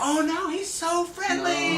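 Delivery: high, slow sing-song mock reassurance; listing agent brushing off a pit bull worry, high and singsong; breezy agent. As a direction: play the agent dismissing the danger sweetly. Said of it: "and the listing agent".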